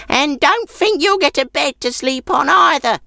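put-on very high, sour old-woman voice. A narrator's reading. Old woman refuses the man a bed, sour high-pitched voice. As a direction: scold an unwanted guest in a high old voice.

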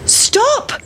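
very high-pitched call, no real volume; mother's shout. A narrator's reading. Mother chasing the runaway pancake, calling out. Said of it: "shouted the mother".

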